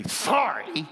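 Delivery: a curt, scornful sorry; disdainful sorry. Retold or imitated. Brother to brother, forced apology, full of disdain. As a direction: spit out a grudging sorry. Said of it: "with as much disdain for one another as we could possibly muster".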